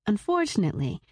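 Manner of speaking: plain reading voice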